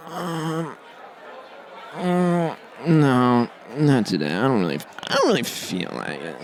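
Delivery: lightly voiced reluctant phone; a phone that can't be bothered; sulky phone. Phone refusing its owner in the tunnel, mild sulk. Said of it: "Here's my phone whenever I go into the subway tunnel".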